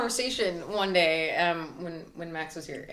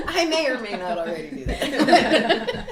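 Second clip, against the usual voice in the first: slow, laughing and louder; 4 semitones higher, 6 dB louder, slower than usual. Real, not acted. Guest laughing while admitting she sees a therapist. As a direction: chuckle through the joke. Joking about seeing a therapist.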